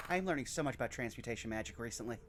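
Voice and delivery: low fast mutter to himself. Acted. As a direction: mumble it under your breath, quickly. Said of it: "mutter to himself".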